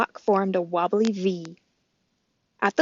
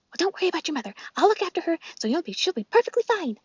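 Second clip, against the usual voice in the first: squirrel voice; 9 semitones higher, faster than usual. Acted. Chit Chat the squirrel.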